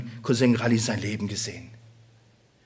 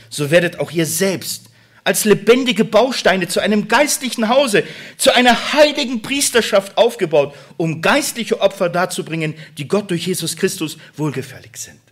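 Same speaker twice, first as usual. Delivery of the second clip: scripture read as preaching; 8 semitones higher, 10 dB louder than usual. Acted. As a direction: proclaim the Bible verse to the church.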